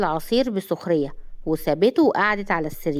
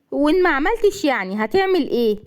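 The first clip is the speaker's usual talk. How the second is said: higher-pitched defiant challenge; stubborn; 5 semitones higher than usual. A narrator's reading. Woman daring the man to act, stubborn. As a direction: dare him, refusing to budge. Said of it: "قالت بعند".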